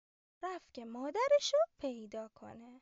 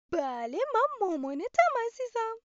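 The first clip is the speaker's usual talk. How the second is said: high, loud hen voice, fond; a hen mum hugging her chick; loving mother hen; 7 semitones higher, 9 dB louder than usual. Acted. Mother hen hugs the chick, high loud fond voice. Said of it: "بغلش کرد و گفت".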